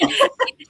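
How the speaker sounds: a quick high giggle; high laugh. Real, not acted. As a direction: laugh lightly after the question. Laughs after "right?.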